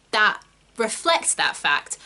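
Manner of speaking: ordinary talk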